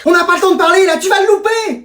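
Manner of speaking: high, panicky hurrying; frantic urgency. Acted. Parent fears child misses plane, frantic. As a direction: cut in, frantic about time.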